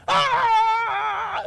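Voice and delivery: sudden high loud scream; loud fake scream. Preacher acting kids screaming to wake a sleeping friend, loud high scream.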